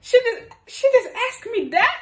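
hammed-up surprised boyfriend; imitating a shocked boyfriend. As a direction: squeal it like a startled guy.

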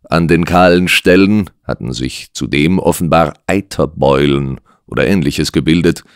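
usual speaking voice

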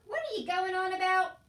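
high, loud exasperated demand; irritated uncle. A narrator's reading. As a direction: bellow in exasperation. Uncle snaps at excited Meg.

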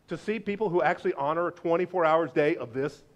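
ordinary delivery

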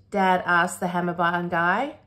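ordinary delivery, nothing marked

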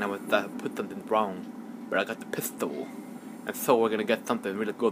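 ordinary talk, nothing marked

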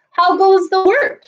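high, loud farmer's call. A narrator's reading. The lazy farmer calls out to the duck, high and loud. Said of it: "called the".

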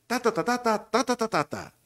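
high, rhythmic ta-ta melody; producer's demonstrated intonation. Shown as a demonstration. Producer showing the announcer the tune, high sing-song demo. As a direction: chant the tune on "ta", pitched well up. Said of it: "Por dar un ejemplo, él".

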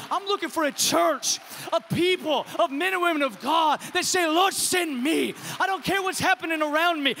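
loud, rising sermon climax over music; loud preacher's call to action; full-force preaching. Acted. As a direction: preach it out with fire.

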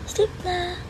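high, soft coo; coaxing pet talk. Real, not acted. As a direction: hush the dog back to sleep. Coaxing dog to sleep.

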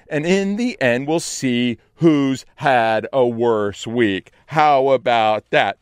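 loud, slow mocking offer; loud sarcastic taunt; sarcastic challenge. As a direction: dare her to compare.

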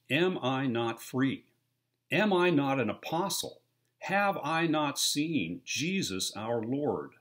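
slow, loud, high preaching of Paul's questions; preacher reading Paul's questions to the church, full force; scripture reading. Acted. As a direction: proclaim each question like Paul himself.

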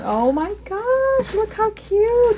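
high gushing "look how cute"; cooing delight. Real, not acted. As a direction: coo over something adorable. Seeing a cute item.

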